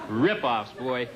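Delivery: normal talking voice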